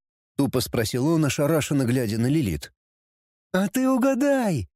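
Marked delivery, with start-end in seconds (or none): 3.5-4.7 high mocking grin in the voice; bare the teeth and taunt; toothy grin. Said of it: "ощерила она жёлтые зубы в улыбке".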